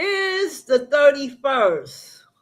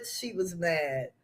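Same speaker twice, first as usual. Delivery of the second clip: much softer, lower, almost to herself; quiet, lower echo of She was mad; soft repeat; 8 semitones lower, 12 dB quieter than usual. Real, not acted. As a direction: drop it to a murmur.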